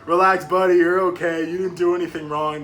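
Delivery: emphatic retold reassurance; reassuring guy on phone. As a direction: talk a panicking buddy down, firmly. Retold man reassuring him on phone.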